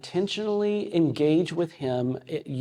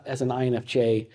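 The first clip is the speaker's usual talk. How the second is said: ordinary delivery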